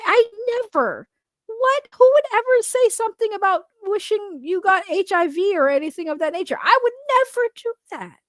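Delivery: high, wide-eyed mock denial; fake innocence. Denying an HIV joke about Lunchbox, high mock innocence. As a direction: play the falsely accused sweetheart. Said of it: "so if y'all didn't realize, I totally did say that".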